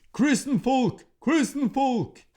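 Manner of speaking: high, loud panicked cry; old troll crying out in terror; terrified cry. A narrator's reading. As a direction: wail the alarm like a fleeing troll. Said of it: "the old troll cried".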